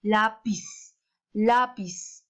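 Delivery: lápiz with a long, stressed LÁ; stress on first syllable. Shown as a demonstration. Teacher asking for the strongest syllable, lápiz stressed on LÁ. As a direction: stretch the lá of lápiz, let piz fall. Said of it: "¿en dónde se encuentra la mayor fuerza?".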